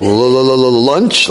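mock tongue-tied stutter on the first sound; flustered stammer. Smitten guy invited to lunch, flustered stammer. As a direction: trip over the L, then blurt it as a question. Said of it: "The guy's, like, dying".

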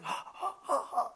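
acted huffing in quick, soft puffs; runners' panting. Storyteller imitating guys running up the steps, mock panting. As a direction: pant like runners climbing stairs. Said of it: "And them guys running".

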